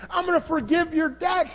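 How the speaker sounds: I'm gonna forgive your debt, shouted out, gonna rising high; shouted promise. Acted. Master shouting to the servant his debt is forgiven, loud. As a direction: proclaim it with a joyful shout.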